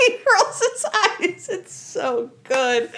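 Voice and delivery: cracking up, high-pitched through real laughter; laughing. Real, not acted. Host relishing a favourite eye-roll scene, laughing. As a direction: laugh helplessly through the words.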